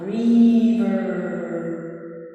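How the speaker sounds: echoing reverb effect; drenched in reverb. Shown as a demonstration. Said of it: "here is, um".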